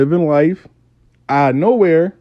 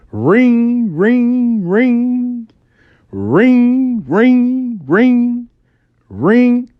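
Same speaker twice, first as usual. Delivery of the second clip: high, sing-song ringing phone noise; vocal ringtone, much higher and louder; phone ring imitation; 11 semitones higher, 4 dB louder, much slower than usual. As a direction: mimic a phone ringing insistently.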